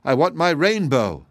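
mildly acted tearful insistence; imperious cry. A narrator's reading. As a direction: demand it with a stamp. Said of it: "cried Polychrome, stamping her foot imperiously".

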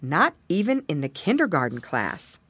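ordinary delivery, nothing marked

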